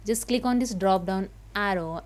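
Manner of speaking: ordinary talk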